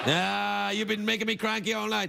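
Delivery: ordinary delivery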